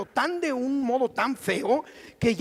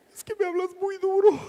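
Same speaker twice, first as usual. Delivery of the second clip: higher, hurt little wife's voice; mock tearful wife; 8 semitones higher than usual. New wife complains husband speaks harshly, mildly tearful. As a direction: play the wife on the edge of tears, lightly. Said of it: "ella empezaba a llorar".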